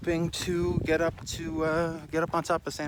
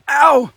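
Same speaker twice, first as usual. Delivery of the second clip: sharp yelp of pain; 11 semitones higher, 16 dB louder than usual. Real, not acted. Leg brushes the cactus.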